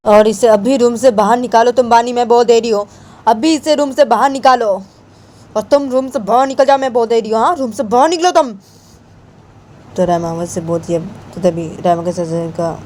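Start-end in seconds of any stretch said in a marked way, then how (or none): 0.1-8.5 high, heated commands; acted furious insistence; angry order. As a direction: order them out in a raised voice. Acted quarrel, ordering out of room.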